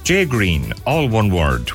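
ordinary delivery

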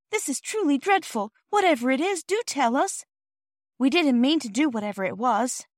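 put-on high-pitched, distressed little girl; despairing plea. A narrator's reading. Girl begging the offended porter to explain, despairing. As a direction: beg to be told, pitched right up. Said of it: "said Phyllis in despair".

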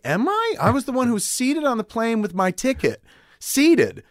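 high-pitched, outraged protest; indignant outrage. Retold or imitated. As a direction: squawk it like the wronged passenger. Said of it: "I was like".

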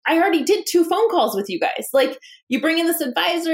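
mock-retold anger at the investors; a retold angry retort; indignant protest. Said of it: "I was so angry".

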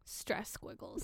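unvoiced whispered echo. Real, not acted. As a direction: whisper it back playfully. Echoing in whisper.

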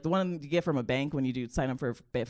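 normal talking voice

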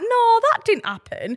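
high, slow mock disbelief; disbelieving people. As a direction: scoff it like a doubter who won't believe you. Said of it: "and be like".

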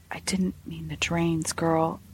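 hushed, unvoiced whisper. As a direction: drop to a secretive whisper. Whispering to the maid.

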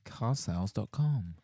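phone hold message. Acted.

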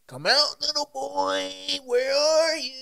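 high, sing-song creepy calling out; mock creepy chaser calling a little boy; creepy taunt. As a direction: lure the kid out like a stalker. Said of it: "He's making noises".

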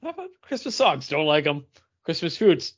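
ordinary talk, nothing marked

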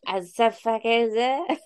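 put-on drunk, slow slurred words; slurred speech. Shown as a demonstration. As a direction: slur it out lazily. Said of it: "I'm pure slurring".

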